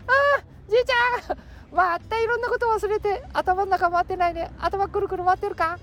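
teasing grandchild voice. Acted. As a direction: tease grandpa for forgetting, high and bright. (高い声でじいちゃんをからかう)

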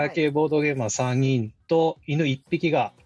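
measured radio introduction, slowing to the close; show opening read. Real, not acted. Guest standing in for the absent host, measured opening announcement. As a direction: present the programme in tidy phrases.

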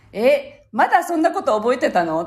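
mock-astonished little boy; surprised young son. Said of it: "息子が".

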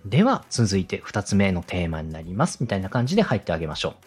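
ordinary talk

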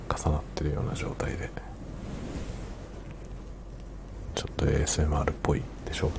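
soft ASMR whisper. Shown as a demonstration. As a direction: breathe the words like an ASMR clip.

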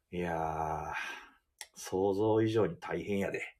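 low, slow Kansai sigh of a manager. Retold or imitated. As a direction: lightly imitate a tired Kansai manager. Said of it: "って言ったら". (監督が疲れを関西弁で低くゆっくり漏らす)

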